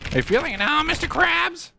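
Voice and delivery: high-pitched quoting voice, climbing at the end; cartoon catchphrase. Cartoon catchphrase, first take.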